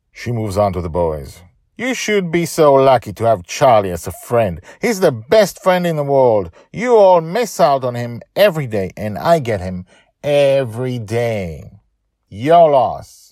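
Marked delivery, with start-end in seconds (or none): 1.8-13.3 high, loud proud praise; defiant girl. Acted. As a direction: tell them off for him. Joy praises Charlie.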